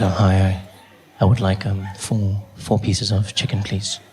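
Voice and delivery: low, hesitant hum; confidential voice. Acted. Customer ordering chicken at the counter, low confidential voice. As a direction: order in a deep, private murmur. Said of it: "because I was speaking like this".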